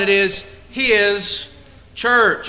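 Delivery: HIS held out, then a pause; emphasis on HIS. Real, not acted. Preacher pointing to a highlighted word in the outline, emphatic on HIS. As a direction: set HIS apart before church. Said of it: "I'm putting emphasis on certain words".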